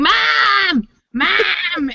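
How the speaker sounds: high, drawn-out mock yell of a child; imitating screaming kid. Kid calling a mom two feet away, mock screaming. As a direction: whine it out high like a kid yelling for attention. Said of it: "when I hear a kid screaming".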